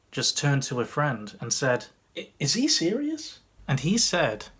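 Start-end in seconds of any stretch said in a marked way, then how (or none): 2.2-3.4 put-on high, incredulous girl's question; imitated girl. Girl in the audience turning to her friend, imitated disbelief. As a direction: mimic a girl asking in disbelief.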